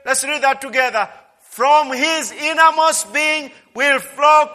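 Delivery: loud, high, fervent preaching; preaching at full force. Acted. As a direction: preach it out to the congregation.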